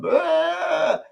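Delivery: sudden high strangled gag sound; imitated choking gag. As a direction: mimic choking on air. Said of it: "You're going".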